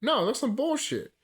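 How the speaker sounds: loud, high, outraged complaint; indignant outburst. Real, not acted. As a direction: protest loudly that it was too short. Outraged the video was short.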